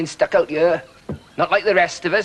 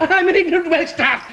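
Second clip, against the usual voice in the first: laughing through pain; 14 semitones higher, 4 dB louder than usual. Acted. Forced confession.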